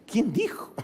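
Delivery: ordinary talk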